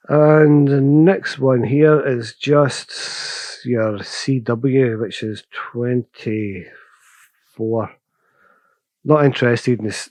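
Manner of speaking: ordinary delivery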